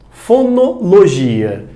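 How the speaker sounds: Fonologia drawn out slowly syllable by syllable; slow spelled-out word. Shown as a demonstration. Teacher to students, slowly stretching Fonologia.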